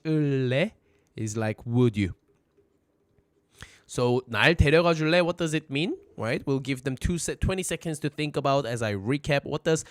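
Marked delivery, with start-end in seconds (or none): none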